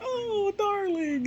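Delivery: high, drawn-out swoon; hammed-up lovestruck sigh of words; adoring. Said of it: "like, vibe she gave".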